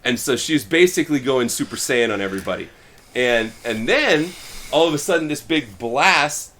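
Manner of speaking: ordinary talk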